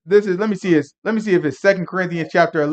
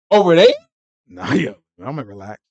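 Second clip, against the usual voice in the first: ordinary delivery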